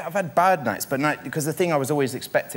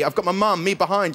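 ordinary delivery

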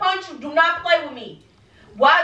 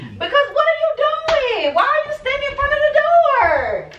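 high, slow, incredulous questioning; exasperated demand; 13 semitones higher, 3 dB louder than usual. Real, not acted. Girlfriend confronting Kamal at the closet door, high and exasperated. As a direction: demand answers, stretched out in disbelief.

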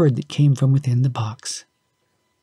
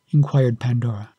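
ordinary delivery, nothing marked

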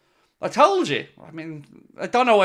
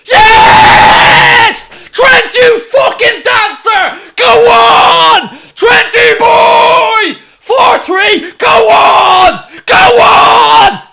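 much higher, much louder roaring celebration; ecstatic screaming; 14 semitones higher, 12 dB louder, much slower than usual. Real, not acted. Fan screams in delight as Trent scores the winner. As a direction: scream the place down with joy.

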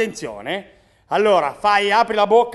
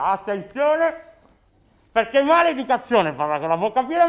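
usual speaking voice

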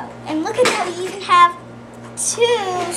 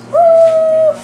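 long, loud, high-pitched "woo"; excited whoop; 10 semitones higher, 9 dB louder than usual. Real, not acted. Child playing with an orange pony, excited whoop. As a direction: let out a big high whoop. Cheering over pony toy.

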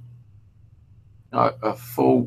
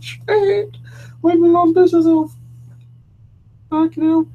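very high, thin squeal of mock shock; squealed disbelief; 7 dB louder than usual. Retelling his shocked reaction, laughing.

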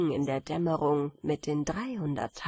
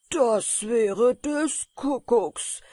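slow, loud, higher exclamation; exclaimed call; 5 semitones higher, 7 dB louder, much slower than usual. A narrator's reading. Miller hears of cake under the bed, exclaiming slowly and loudly. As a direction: stretch out every word in loud amazement. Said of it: "rief der Müller".

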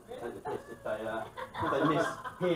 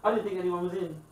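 surprised; 6 semitones higher, 4 dB louder than usual. Retold or imitated. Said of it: "She goes".

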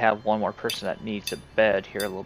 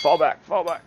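loud, urgent, higher call to retreat; shouting orders over battle; 5 semitones higher, 6 dB louder than usual. Real, not acted. Commanding colonists during wolf attack.

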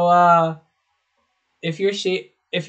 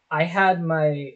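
ordinary talk, nothing marked

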